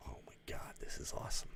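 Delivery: awed hushed whisper; delighted whisper. Retold or imitated. Viewer marveling at the bathtub scene, whispered. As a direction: whisper it in thrilled awe.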